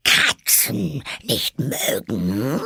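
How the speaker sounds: slow, high screechy mouse outrage; screeching, furious. A narrator's reading. The mouse echoes Alice's words, screeching and furious. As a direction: throw the words back in drawn-out outrage. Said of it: "schrie die Maus mit kreischender, wütender Stimme".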